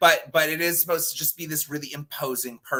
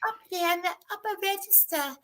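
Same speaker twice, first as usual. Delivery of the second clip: much higher, soft and breathy-thin; Ellen Greene voice; 5 dB quieter than usual. Shown as a demonstration. Describing Ellen Greene's voice in her much higher register. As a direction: lift into a tiny high head voice. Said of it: "high-pitched voice".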